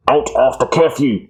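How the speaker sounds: lightly voiced alien guard, a touch stern; a hint of a barking insect soldier; mild alien bark. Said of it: "They bark at you".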